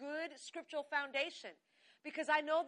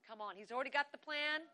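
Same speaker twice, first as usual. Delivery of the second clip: usual speaking voice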